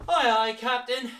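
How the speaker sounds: high, loud sailor's salute. Shown as a demonstration. Teacher playing a sailor answering the captain, high and loud. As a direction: shout it like an eager deckhand.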